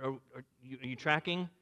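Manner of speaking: ordinary talk, nothing marked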